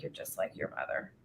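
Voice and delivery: very quiet, low retold father; hushed, softened king's voice. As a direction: let it sink to a murmur. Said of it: "he just softens up".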